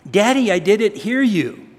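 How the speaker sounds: put-on pleading like a small child; little daughter voice. Preacher mimics his small daughter, in a high innocent voice. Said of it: "she said that".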